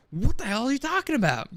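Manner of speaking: high-pitched exasperated disbelief; incredulous outburst. Retold or imitated. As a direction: soar up high, exasperated, then fall. Incredulous retort to overheard opinion.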